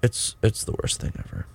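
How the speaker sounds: hushed, breathy whisper of disgust. Real, not acted. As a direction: drop to a whisper on the repeat. Repeats complaint, now whispered.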